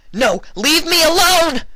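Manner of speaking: very high, loud scream of terror; shrieking no, leave me alone; terrified scream. Acted. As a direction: scream for your life. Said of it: "Vinyl screamed in terror".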